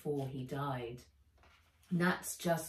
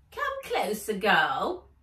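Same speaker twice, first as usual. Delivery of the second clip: a greedy little elf, pitched way up; 2 semitones higher, 7 dB louder, slower than usual. Acted. Elf pulls Nat in.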